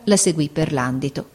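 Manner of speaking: plain reading voice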